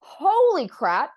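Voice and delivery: slow, loud, drawn-out amazement; awed exclamation; stunned. Retold or imitated. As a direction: let the realization hit hard. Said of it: "and I went".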